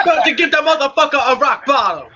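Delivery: high, loud hammed-up threat; mock wrestling threat. Joking about a rock bottom, mock threatening shout. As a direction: holler it like a wrestling challenge.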